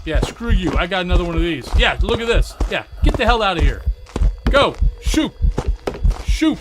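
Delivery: loud taunting yell; defiant shouting. Real, not acted. Yelling at the hunting ghost.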